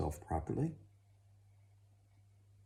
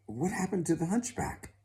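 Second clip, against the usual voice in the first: baffled father; 12 semitones higher, 4 dB louder than usual. Acted.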